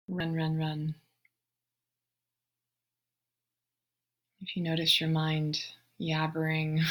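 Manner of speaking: soft, unhurried meditation voice with long pauses; guided meditation. Real, not acted. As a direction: guide them gently into the breath, hushed and spacious. Leads a breathing meditation.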